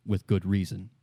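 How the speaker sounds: normal talking voice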